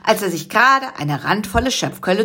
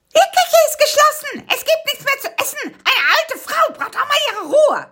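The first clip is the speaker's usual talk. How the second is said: put-on shrill old woman. The aunt shouts from the kitchen at intruders, shrill and high. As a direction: screech it like a cranky old cook. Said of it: "schrillte eine Stimme".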